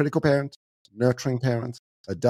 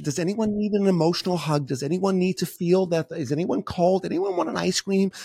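much higher, sing-song soothing; nurturing parent soothing the group at the roadside, high and sugary; doting nurturing parent; 7 semitones higher than usual. Retold or imitated. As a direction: coo over everyone like a fussy mother. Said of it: "Starts to nurture".

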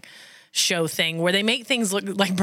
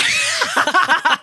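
high squeal laugh; 7 dB louder than usual. Real, not acted. Teased after "no one asked.

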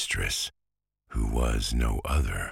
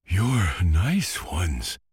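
slow, breathless and a bit louder; panting; 7 semitones higher, 4 dB louder, slower than usual. Acted. Cabbages scold the children, panting. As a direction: puff it out as if winded. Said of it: "panted the cabbages".

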